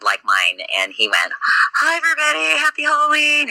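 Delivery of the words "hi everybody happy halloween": much higher mock falsetto of her cheery greeting; husband playing her on the live feed, sing it up high; imitating his wife. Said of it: "he was me at the very beginning".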